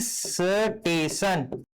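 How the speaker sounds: स्टेशन drawn out very slowly as "steshan"; slow careful pronunciation. Shown as a demonstration. Teacher showing students the full word स्टेशन, very slowly. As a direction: stretch स्टेशन slowly, no added vowel. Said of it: "या तो स्टेशन कही".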